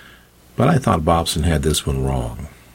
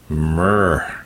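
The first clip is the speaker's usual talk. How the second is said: rolled cat-like trill; cat's murr. A narrator's reading. Cat replies to owner's suggestion, murring. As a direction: answer with a drawn-out murr.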